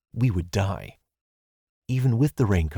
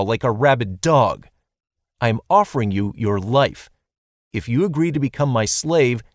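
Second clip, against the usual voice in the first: louder, rising leader's speech; grand speech; 3 semitones higher, 4 dB louder than usual. Acted. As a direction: deliver it with full pathos. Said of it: "Oof, what pathos".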